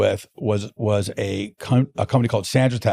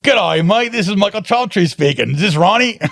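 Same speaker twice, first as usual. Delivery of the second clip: higher, hammed-up Australian accent; mock an Australian caller on the phone; 8 semitones higher than usual. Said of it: "there's an Australian accent".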